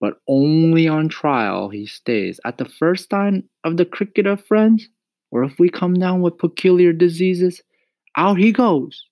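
high, loud warning from mother; stern mama. A narrator's reading. Mama sets terms for keeping the cricket, warning. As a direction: lay down conditions emphatically, high. Voices Mama setting conditions.

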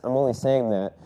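normal talking voice

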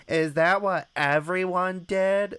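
high, slow airhead voice; mock naive girl. Mimicking a sorority pledge who believes anything, mock naive. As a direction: gullible question in a hammed-up high pitch. Imitates a gullible woman.

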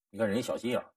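normal talking voice

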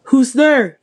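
loud challenging shout into the dark. Acted. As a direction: shout it out, on guard. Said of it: "he shouted".

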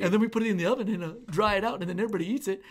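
lightly voiced proud American, a touch of smugness; mock America voice. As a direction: playfully show off a bland turkey feast. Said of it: "America's like".

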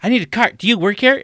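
high and loud, repeated pushy question; mock Karen demand. Mimicking the customer asking if he works there. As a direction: hound him like an entitled shopper. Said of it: "She's like".

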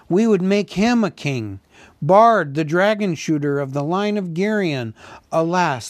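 acted crowd lament, lifted in pitch; fervent lament. A narrator's reading. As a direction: proclaim the lost hero with regret. Townsfolk acclaim and lament lost hero.

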